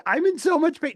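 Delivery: high, whiny pained complaint; mock whimper. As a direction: whimper it like a hurt kid. Narrator whines about injury.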